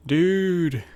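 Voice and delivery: laid-back surfer dude drawl. Acted. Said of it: "trying to improve my surfer voice".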